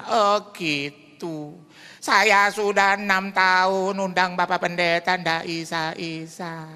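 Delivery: put-on high, slow old lady voice; lightly voiced elderly widow; old woman caller. Old widow phoning the pastor, high and drawn out.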